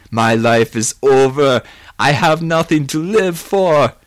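loud, drawn-out lament; despairing. Acted. Suicidal Johnny laments his life.

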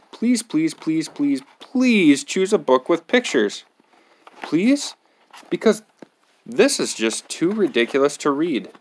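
high, pleading whine; begging. Acted. Reader begging the kid for picture books, high whine. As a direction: beg the kid, voice rising.

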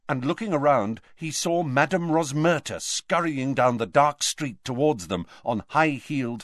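ordinary talk

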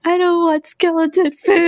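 high, whiny refusal; hammed-up pretend whine; mock crying child. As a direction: play a tearful kid turning it down. Crying child refuses skeleton's food.

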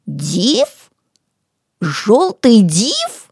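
slow questioning echo, ДИВ rising; drawn-out sceptical query, no fear; unafraid, incredulous. A narrator's reading. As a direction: repeat it as a doubting question. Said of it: "нисколько не испугавшись".

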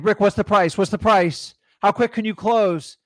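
mock demanding seller; pushy seller. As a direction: fire questions like an impatient seller. Seller pestering with rapid questions.

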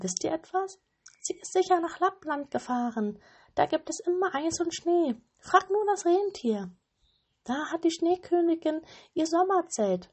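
high, brighter bird voice telling the way; pigeon voice. A narrator's reading. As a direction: chirp the directions like a dove. (hohe Taubenstimme)

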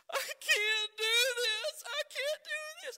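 put-on high, loud, crying child's wail; son's crying wail. Dad imitating his crying son, high and loud. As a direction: wail it like a hurt six-year-old.

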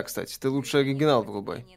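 ordinary talk

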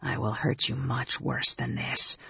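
soft, menacing hiss; hissed threat. A narrator's reading. Raistlin threatening Anne Beryl as he grips her, hissing softly. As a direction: threaten softly under your breath. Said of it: "Raistlin hissed".

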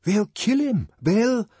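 high-pitched cry of alarm, cut off; urgent, anxious outburst. A narrator's reading. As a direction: fear for a friend facing a mob. Bernard alarmed as mob turns.

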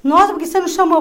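loud, high gasp of surprise; be startled by the news; shocked neighbour. Retold or imitated. Neighbour is shocked to hear about the attacker, loud and high.

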